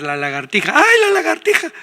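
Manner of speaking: very high-pitched imitation of frightened screaming; playful falsetto shriek of alarm; mock scream. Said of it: "Y todos va de gritar".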